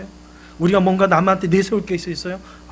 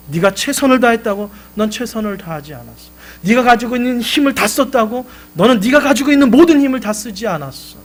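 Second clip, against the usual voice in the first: higher and louder, sharp rebuke; scolding father; 6 semitones higher, 8 dB louder than usual. Retold or imitated. Father scolding his son who gave up, loud rebuke. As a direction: scold him for not trying. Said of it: "야단을 칩니다".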